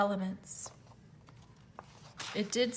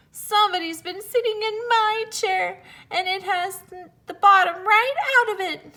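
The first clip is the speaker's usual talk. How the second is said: put-on much higher tiny baby bear; little wee bear voice; 10 semitones higher, 6 dB louder than usual. A narrator's reading. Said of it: "in his little small wee voice".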